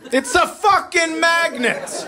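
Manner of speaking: slow, high-pitched exasperated yell; exasperated outburst. Acted. Parent fed up with magnet questions, exasperated. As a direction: draw it out high, at wit's end.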